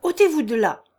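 loud, high abrupt command; sudden shout. A narrator's reading. Peasant ordering workmen out of the way, sudden shout. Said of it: "cria tout à coup une voix".

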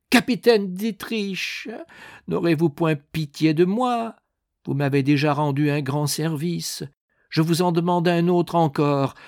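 high-pitched, urgent entreaty; pleading. A narrator's reading. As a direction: beg a favour from your sickbed. Dying man begs captain for relief.

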